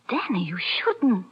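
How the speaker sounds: whispered plea. Acted. Gail pleads softly.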